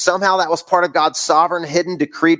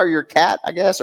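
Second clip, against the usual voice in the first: ordinary delivery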